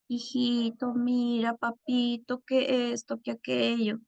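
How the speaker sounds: put-on high, drawn-out, sugary pleading with a child; coaxing, not correcting. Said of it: "ni siquiera suena corrección".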